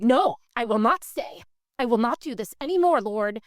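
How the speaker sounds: loud, punchy retold refusal; pissed-off defiance. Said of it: "I was pissed".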